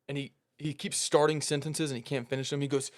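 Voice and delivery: ordinary talk, nothing marked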